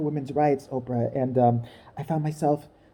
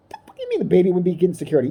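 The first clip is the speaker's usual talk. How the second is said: fast outrage; faster than usual. Real, not acted. Outraged rant.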